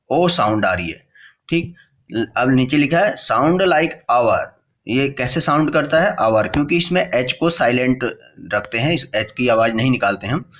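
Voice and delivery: normal talking voice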